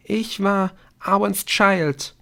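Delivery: high, slow confession; tearful. A narrator's reading. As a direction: reveal it haltingly after a sob. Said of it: "Sie schluchzte ein weiteres Mal kurz auf".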